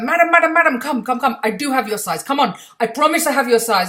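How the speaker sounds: high, loud pushy Indian seller; insistent seller. Retold or imitated. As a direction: coax her over like a persistent hawker. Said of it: "the other seller who'd be like".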